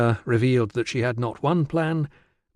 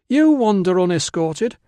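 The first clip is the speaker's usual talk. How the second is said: high, louder sulky retort; pouting; 8 semitones higher, 5 dB louder than usual. Acted. Virana answers Tilla's scolding back, pouting. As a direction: throw it back with a girlish pout. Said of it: "Virana pouted".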